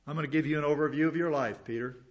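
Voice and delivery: usual speaking voice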